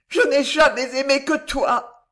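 high, loud avowal of love; impassioned declaration. A narrator's reading. Girl assures the dying man of her love, high and fervent.

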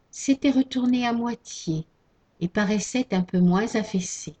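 ordinary delivery, nothing marked

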